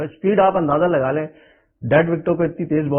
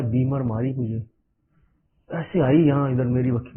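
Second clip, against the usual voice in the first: ordinary talk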